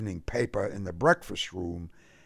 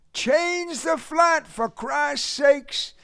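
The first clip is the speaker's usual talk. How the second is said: high, slow, drawn-out Southern cry; exasperated cry; 4 dB louder, slower than usual. A narrator's reading. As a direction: cry out high, stretching every word. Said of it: "his brother cried".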